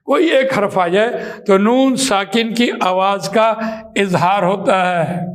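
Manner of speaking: usual speaking voice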